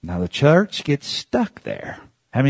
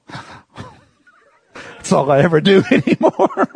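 laughing at own tears; 7 semitones higher than usual. Retold or imitated. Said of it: "I said".

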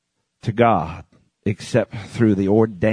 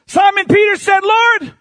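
high, forceful pulpit cry; fiery preaching; 4 dB louder than usual. Real, not acted. Preacher hammering Jesus's words to the congregation, fiery. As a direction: preach it out, pitched high and driven.

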